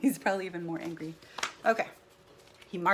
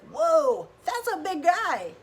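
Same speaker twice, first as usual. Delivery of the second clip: scared Gerald; 9 semitones higher, 10 dB louder, slower than usual. Acted. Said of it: "he's really scared".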